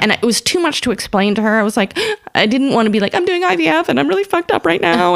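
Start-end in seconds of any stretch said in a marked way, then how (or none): 3.1-5.2 high-pitched, overwrought blurting; mock tearful confession. Comic imagines over-explaining to her idol, mock tearful. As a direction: wail it out as an exaggerated confession.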